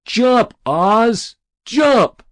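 high, loud cheer of encouragement; egging-on shout. A narrator's reading. Friend in the crowd to the boy on the roof, shouting. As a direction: urge him on at full voice. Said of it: "shouted a lone word to the boy".

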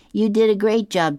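high and loud, hammed-up boy praising; booming high praise for Chutney; Curtis voice.

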